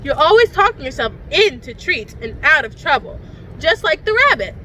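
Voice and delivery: ordinary delivery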